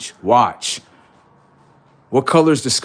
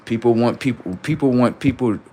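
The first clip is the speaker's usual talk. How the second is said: ordinary talk, nothing marked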